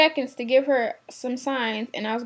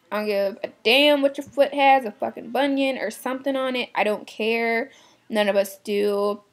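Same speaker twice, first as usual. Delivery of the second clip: usual speaking voice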